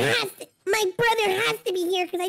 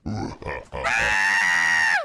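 deep growling monster call. Pretend. Brother pretends to be a monster, deep growl. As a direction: growl low like a lurking beast. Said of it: "I told you that was a monster".

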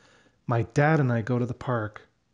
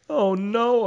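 dismayed oh no; 10 semitones higher, 4 dB louder than usual. A narrator's reading. Child sees kite vanish.

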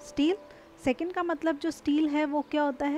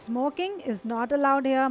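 smoking is not allowed here, said very slowly; slow example; much slower than usual. Shown as a demonstration. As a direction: read the notice slowly. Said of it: "Allowed मतलब". (धीमा उदाहरण)

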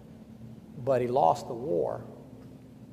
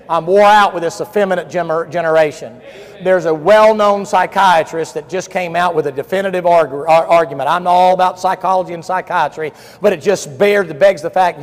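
loud, high, hammering preacher voice; fiery rebuke from the pulpit; full-force preaching; 4 semitones higher, 11 dB louder than usual. Acted. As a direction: scold the generation from the pulpit.

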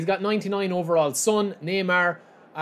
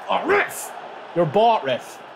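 angry protest; 3 semitones higher, much slower than usual. Real, not acted. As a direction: accuse the ref of being paid off. Protesting foul to referee.

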